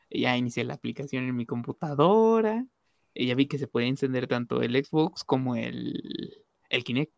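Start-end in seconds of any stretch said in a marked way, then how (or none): none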